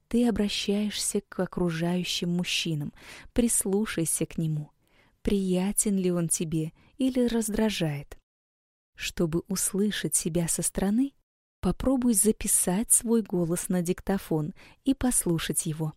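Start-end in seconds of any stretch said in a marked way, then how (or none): none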